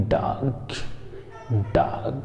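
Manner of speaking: डॉग with an open aw, neither 'daag' nor 'dohg'; correct English vowel. Shown as a demonstration. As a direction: demonstrate डॉग slowly with rounded 'aw'. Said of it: "क्या बोलेंगे". (डॉग में खुला ऑ बोलें)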